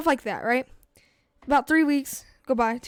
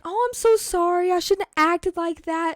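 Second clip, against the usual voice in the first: higher-pitched mock pleading; whiny apology; 5 semitones higher than usual. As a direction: imitate an ex begging forgiveness in a high voice. Mocks ex-girlfriend's pleading apology.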